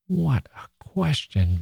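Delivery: whispered, slow indignation; indignant whisper. A narrator's reading. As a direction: hiss the outrage under breath. Indignant aside.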